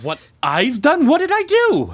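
shocked he's being blamed, voice jumping up; incredulous. Acted. Priest stunned by accusation.

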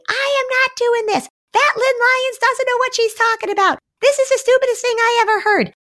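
very high mock child protest; hammed-up indignant child, squeaky high; defiant kid. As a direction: put on an outraged kid refusing to go along. Predicting a child's angry protest.

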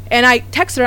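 usual speaking voice

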